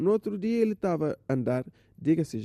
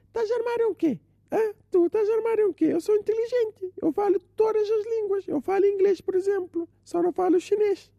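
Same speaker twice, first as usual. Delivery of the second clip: high, indignant show-off uncle; a boastful uncle talks down to his nephew in a high voice; arrogant uncle. Acted. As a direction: puff up and snap back like a know-it-all uncle.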